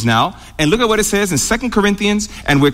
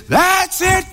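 mock scream; 12 semitones higher than usual. Grandmother's blood boiling at the child, high mock yell. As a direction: snap high, like a grandmother at her limit. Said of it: "And she screamed and yelled at that child".

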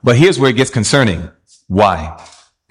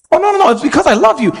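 higher, quick mock protest; 11 semitones higher, faster than usual. Preacher mocking a loved one's defensive excuse. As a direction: play the defensive excuse-maker.

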